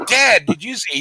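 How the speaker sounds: high, loud imitation of a boy; excited kid. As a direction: call to dad like an amazed youngster. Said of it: "them two boys".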